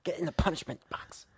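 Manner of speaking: hushed, whispery British wife impression; mock British wife. Cohost imitating the host's wife ordering him in, whispered mock British. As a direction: put on a British voice, mostly whispered. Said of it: "that's the biggest problem with that impression".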